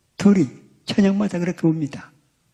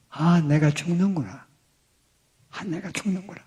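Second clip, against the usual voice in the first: softer, fading inner despair; despairing murmur; 2 semitones higher, 6 dB quieter than usual. Retold or imitated. Wife realizing she will die, murmuring softly in despair. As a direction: let it sink away to almost nothing.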